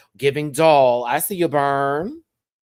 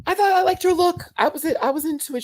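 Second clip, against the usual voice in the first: excited; 5 dB louder than usual. Real, not acted. High excited talk.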